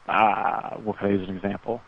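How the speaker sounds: ordinary talk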